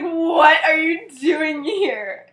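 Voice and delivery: high, loud, drawn-out outburst; slow, hammed-up shriek of startled protest; mock scream. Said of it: "then he, like, screams".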